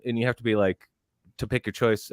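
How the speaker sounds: ordinary talk, nothing marked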